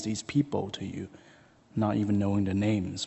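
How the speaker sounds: soft, low closing prayer. Acted. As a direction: pray gently over the families. Closing prayer over a congregation.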